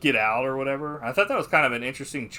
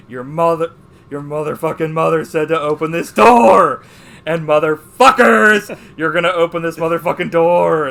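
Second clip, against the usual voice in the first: imitated furious yelling; 5 semitones higher, 16 dB louder than usual. Father yells at kids through a locked basement door, furious mock shouting. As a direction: roar it like a movie dad losing it.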